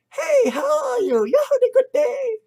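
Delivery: high, loud fake-friendly hello; mock chummy greeting. Mocking enemies being friendly.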